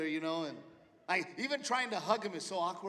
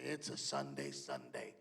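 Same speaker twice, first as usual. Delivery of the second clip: much lower, soft, drawn-out Sunday; dad's deep voice; 8 dB quieter, slower than usual. Retold or imitated. Dad at the kitchen table, deep announcing voice. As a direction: drop way down low and slow. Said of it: "he would say".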